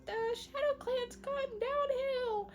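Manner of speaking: high, drawn-out squeaky complaint; whiny apprentice kids. Acted. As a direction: do kid apprentices griping in a high put-on voice. Apprentices complain in high put-on voices.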